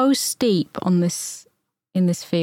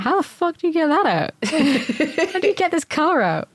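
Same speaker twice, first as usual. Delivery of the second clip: incredulous; 8 semitones higher, faster than usual. Retold or imitated. Baffled by a fenced-in car.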